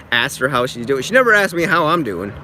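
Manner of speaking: ordinary talk, nothing marked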